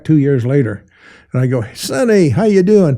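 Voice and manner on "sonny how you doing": high, loud cheery hello; hearty greeting. Retold or imitated. Greeting Sonny on his return to Hawaii, high and loud. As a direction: call out to an old friend.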